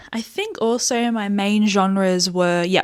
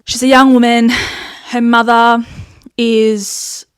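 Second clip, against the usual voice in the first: normal talking voice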